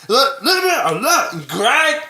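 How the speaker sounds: Lumpy Space Princess voice as Tommy. Retold or imitated. Friend blending Tommy with the cartoon princess, whiny. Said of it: "are the same cat person".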